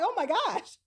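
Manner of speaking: shocked gasp. Retold or imitated. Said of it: "I'm like".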